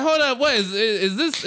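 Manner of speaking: high-pitched, stammering bewilderment; mock confusion. Comic on hearing his introduction, acted confusion. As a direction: act out being thrown, restarting the question. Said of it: "I was confused".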